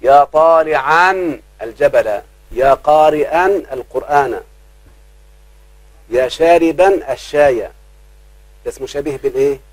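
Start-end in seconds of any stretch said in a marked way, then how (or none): 0.0-7.6 slow, stretched example calls; teacher reading the example calls slowly; slow recited examples. Shown as a demonstration. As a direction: recite each call slowly and deliberately. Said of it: "الشبيه بالمضاف يبقى منون".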